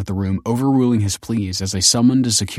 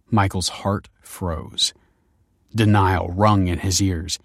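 plain reading voice

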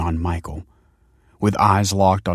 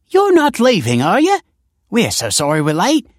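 crowing auntie; 10 semitones higher, 7 dB louder than usual. A narrator's reading. Auntie apologises to Michael for being late, crowing. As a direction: crow apologies in a high-pitched auntie voice. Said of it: "she crowed".

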